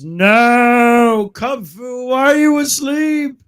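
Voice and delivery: high, drawn-out cry of protest; dismayed wail. Real, not acted. Player crying out to sleeping Kung Fu, dismayed wail. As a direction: wail it high, stretching out the words. Game creature put to sleep, wails.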